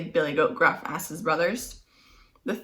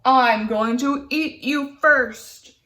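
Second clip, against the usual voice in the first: loud troll roar; troll blocking the middle goat, shouting; 5 semitones higher, 4 dB louder, slower than usual. Acted. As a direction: bellow it like a furious troll. Troll threatens.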